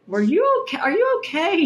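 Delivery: a hint of an emotional dad asking after his daughter; worried dad. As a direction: play the concerned father checking in. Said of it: "He really looked emotional".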